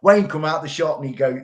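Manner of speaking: ordinary talk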